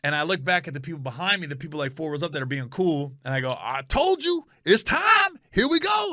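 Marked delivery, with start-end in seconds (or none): 3.6-6.1 much higher, triumphant gloat; excited taunt. Retold or imitated. Comic gloating to the fans behind him, high and excited. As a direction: rub it in after the score.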